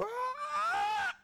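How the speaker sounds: high piercing shouted screech; eagle cry. Retold or imitated. Preacher mimics an eagle soaring overhead, piercing screech. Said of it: "Is that an eagle sound?".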